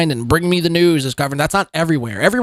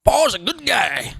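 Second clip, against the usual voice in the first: higher-pitched mock Southern accent; imitated Southern accent; 4 semitones higher than usual. Host mocking the streamer's put-on voice, higher Southern accent. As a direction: parody his slip into a Southern accent. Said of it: "this voice he's got going on here".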